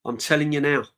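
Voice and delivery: usual speaking voice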